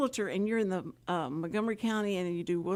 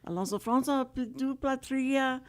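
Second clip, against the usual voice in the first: hammed-up fake French accent on Alonso Franza; mock French; 4 semitones higher than usual. Shown as a demonstration. Host showing off her leftover school French, mock French accent. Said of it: "if you do the accent".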